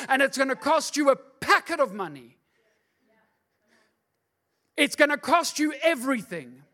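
loud, high, driving sermon; full preaching. Acted. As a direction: preach it hard to the congregation.

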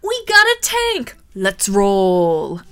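loud, hammed-up action-movie line; trailer one-liner. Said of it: "could you reel these off".

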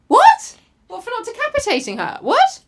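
disbelieving high what; incredulous outburst; shocked. Real, not acted. Shocked at the ending.

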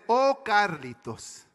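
put-on slow, weighty Panamanian accent; Panamanian father. Panamanian immigrant dad answering his son, slow accented voice. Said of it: "so my dad said".

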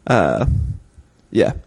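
normal talking voice

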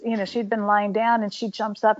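ordinary talk, nothing marked

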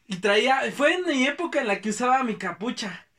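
ordinary talk

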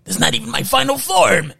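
high, strained monster taunt; mock movie monster. Retelling Henrietta's transformation to a co-host, high hammed-up monster voice. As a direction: announce it like a transforming horror villain.

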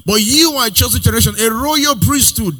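high, loud rising preacher cadence; preaching at full force. Acted. Preacher assuring the congregation they are exempted, full force. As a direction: build it up like a revival preacher.

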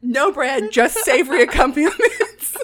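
loud, high, through real laughter; barely getting words out for laughing. Real, not acted. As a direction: crack up while saying it. Cracking up at the absurd course.

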